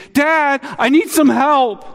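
high, slow plea for help; a child begging dad, urgent and high; distressed child's cry. Retold or imitated. Child cries to dad for help, high and pleading. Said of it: "a distressed cry".

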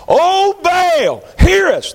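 high, slow, straining cry rising to a shout; mock the prophets crying out to Baal; frantic Baal prophet cry.

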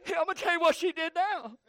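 a hint of a fed-up husband venting, climbing to a high, loud rant; griping to sister. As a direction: whine it out, building to a shout. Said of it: "Call your sister".

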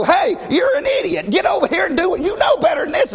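screaming coach. Retold or imitated. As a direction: bark at the player like an angry coach. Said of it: "they're gonna scream at you".

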